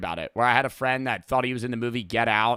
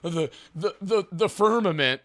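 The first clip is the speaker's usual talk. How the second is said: higher-pitched mock tripping ramble; stammering, pitched-up parody of druggy philosophizing; spewing crazy shit; 4 semitones higher than usual. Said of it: "he's just spewing fucking crazy shit".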